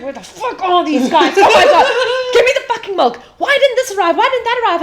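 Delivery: high, loud frantic barking of questions; loud frantic mock panic, laughing; panicked owner. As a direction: play the stressed boss losing it behind the machine.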